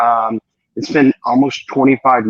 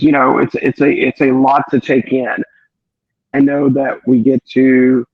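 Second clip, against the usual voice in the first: normal talking voice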